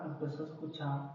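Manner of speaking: soft fading repeat of "empezó a escuchar"; echoed softly. Shown as a demonstration. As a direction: let the echo die off.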